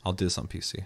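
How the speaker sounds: quiet aside to himself; muttered decision. Retold or imitated. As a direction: say it under your breath. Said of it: "I'm like".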